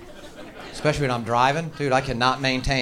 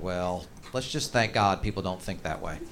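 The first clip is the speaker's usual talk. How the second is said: low, slow drawl of an army colonel; 9 semitones lower, 8 dB quieter, slower than usual. Retold or imitated. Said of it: "And he's like".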